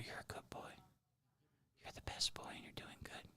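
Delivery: breathy unvoiced whisper into an ear. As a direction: hush it like a secret in his ear. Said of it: "while whispering into his ear".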